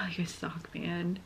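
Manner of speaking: soft sighing whisper of mock blame; teary whisper. Real, not acted. Teasing the singers while near tears, whispering. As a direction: whisper it through a sigh. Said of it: "I'm gonna end up crying".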